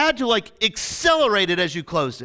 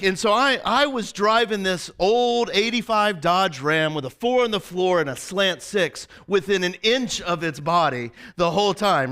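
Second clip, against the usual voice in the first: usual speaking voice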